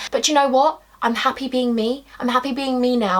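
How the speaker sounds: ordinary delivery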